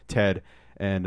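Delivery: usual speaking voice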